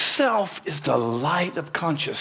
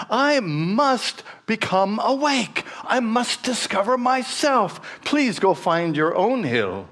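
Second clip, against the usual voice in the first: earnest monk; 9 semitones higher than usual. Acted. Monk pleading with cow.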